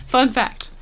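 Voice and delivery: ordinary talk, nothing marked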